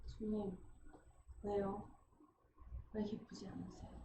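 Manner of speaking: soft, hushed questioning of the Lord; hushed prayer. Retold or imitated. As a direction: ask gently in bewildered prayer. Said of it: "깜짝 놀랐어요".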